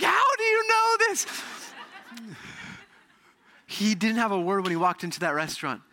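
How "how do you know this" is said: much higher, louder, laughing through the question; laughing astonishment. Retold or imitated. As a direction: echo her shock with a laugh. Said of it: "I'm like".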